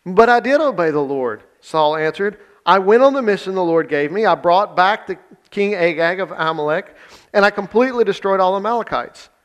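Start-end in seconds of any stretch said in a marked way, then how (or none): 0.0-1.4 higher, louder defensive reading; protesting. A narrator's reading. Reading Saul's protesting reply to Samuel. 2.6-9.3 higher, louder defensive reading; protesting. A narrator's reading. Reading Saul's protesting reply to Samuel.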